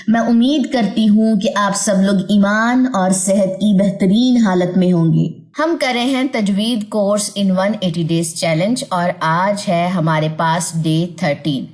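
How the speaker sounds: usual speaking voice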